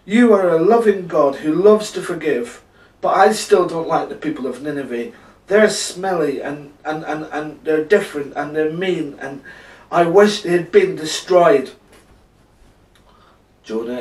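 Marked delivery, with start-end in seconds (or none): 0.0-11.7 loud, raised-voice whinge; groaning complaint. A narrator's reading. Jonah complaining to God about Nineveh, loud groaning. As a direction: moan it out loudly, piling up grievances. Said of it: "he groaned".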